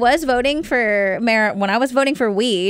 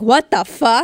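imitated amazement; 4 dB louder, slower than usual. Imitating people in newly legal states, amazed exclamation. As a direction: exclaim it as an astonished newcomer. Amazed reaction where it is new.

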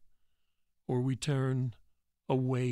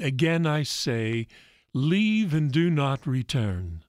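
slow command; 2 semitones higher, 8 dB louder, slower than usual. Acted. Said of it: "Jesus continued".

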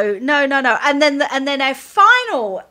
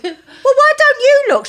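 snappy retort; 12 semitones higher, 5 dB louder than usual. Retold or imitated. Retold snappy reply.

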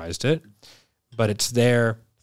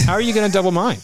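high, loud question through laughter; laughing; 9 semitones higher, 4 dB louder, much faster than usual. Retold or imitated. Mocking the sales rep's promise to a friend, laughing. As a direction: laugh it out in disbelief.

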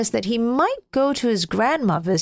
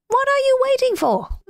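much higher, urging snowman; eager snowman. Acted. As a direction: hurry him along. Snowman urges.

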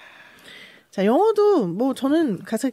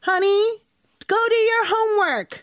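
nagging parent; 10 semitones higher, much slower than usual. Acted. Parent voice in role-play.

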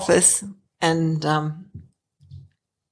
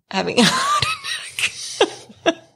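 high, drawn-out words breaking into laughter; slow, helpless laugh squeezing the voice high; laughing through words; 3 dB quieter, much slower than usual. Real, not acted. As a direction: crack up while saying it. Laughs while retelling her call.

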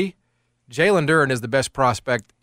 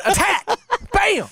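high-pitched mock attack cry; excited, hammed-up play-by-play of an attack; excited re-enactment; slower than usual. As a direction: act out the sudden attack, pitch shooting way up. Acting out the warthog attack.

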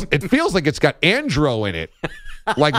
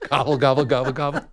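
gobbling noises; 2 semitones higher, faster than usual. Pretend. Mimicking baby gulping milk.

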